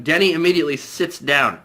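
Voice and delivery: normal talking voice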